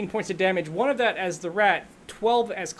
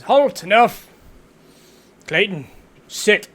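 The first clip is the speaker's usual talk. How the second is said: commanding drill sergeant shout; stern war master; 11 dB louder than usual. Acted. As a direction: bark orders at the class. GM voicing War Master scolding students.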